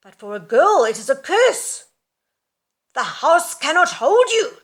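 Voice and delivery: high, loud cursing mother's voice; furious mother. Acted. Angry mother cursing her daughter, loud and high. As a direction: hurl the curse at the daughter.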